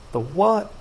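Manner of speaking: plain reading voice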